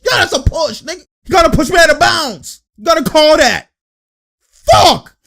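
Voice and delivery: high, yelled mock protest to the ref; imitating complaining player. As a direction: act out an indignant player whining at the call. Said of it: "He's like".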